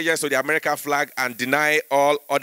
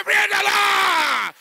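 very high, slow shouted "Praise the Lord!"; preacher roaring to the church; much slower than usual. Retold or imitated. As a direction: bellow it like a Lagos preacher. Shouting Nigerian pastor.

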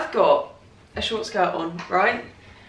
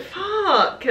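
much higher, delighted curse; excited swear; 11 semitones higher than usual. Real, not acted. Overcome by the gift, a high delighted curse. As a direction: blurt it out thrilled. Overwhelmed by present.

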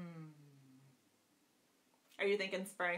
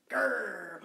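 growl; 6 semitones lower than usual. Pretend. Bear puppet growls.

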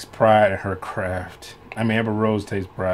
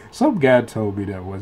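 usual speaking voice

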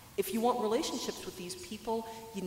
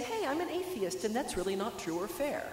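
higher-pitched, gentle objection; 4 semitones higher than usual. Acted. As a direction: say it gently, pitched up high to start. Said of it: "when to kind of gently say".

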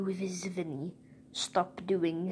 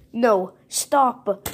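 loud protest; 4 semitones higher, 8 dB louder than usual. Pretend. Toy protests again.

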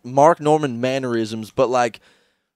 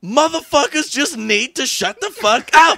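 imitating Chris Rock; 12 semitones higher than usual. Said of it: "Chris Rock shit".

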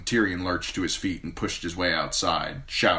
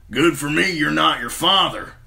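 Bronn voice; 9 semitones higher, 7 dB louder than usual. A narrator's reading.

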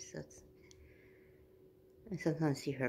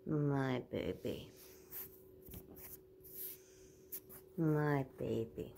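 slow tender cooing; 6 dB quieter, slower than usual. Real, not acted. Cooing over her dog.

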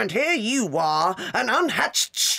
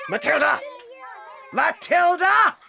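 rising roar of a name; furious shout; 9 semitones higher, 3 dB louder than usual. Acted. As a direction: bellow the name. Headmistress summons accused pupil.

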